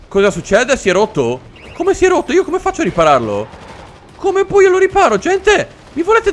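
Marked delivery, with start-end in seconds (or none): 1.7-3.5 high-pitched, dismayed question; incredulous outcry. Real, not acted. Player learns the machine broke, incredulous outcry. 4.2-6.3 high, louder, helpless plea; exasperated wail. Real, not acted. Player asking teammates how to fix it, exasperated wail.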